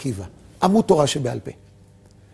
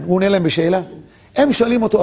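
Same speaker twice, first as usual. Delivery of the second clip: ordinary talk, nothing marked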